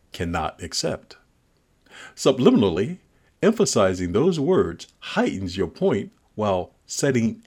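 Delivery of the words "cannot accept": "cannot accept." pressed, quiet and level; stress on cannot accept. Shown as a demonstration. Naming the stressed words for the listener, pressed. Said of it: "with the emphasis on".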